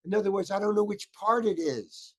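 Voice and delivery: high-pitched exasperated protest; worked up. Retold or imitated. Actor protesting to secretary about ninety pages, worked up. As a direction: retell a panicked complaint, pitch climbing. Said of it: "I'm, I'm really getting worked up".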